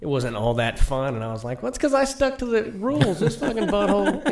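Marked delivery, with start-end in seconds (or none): 1.6-4.3 high, loud exasperated comeback; indignant retort. Retold or imitated. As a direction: snap back, fed up and joking.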